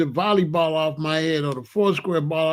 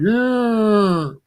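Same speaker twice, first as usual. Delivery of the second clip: a higher, louder ghostly voice answering; otherworldly yeah; 5 semitones higher, 4 dB louder than usual. Retold or imitated. Angel answering a dying man, higher ghostly voice. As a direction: play the angel's calm reply.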